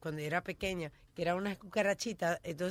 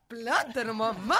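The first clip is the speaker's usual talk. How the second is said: ad catchphrase; slower than usual. Retold or imitated. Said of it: "veo un plátano grande".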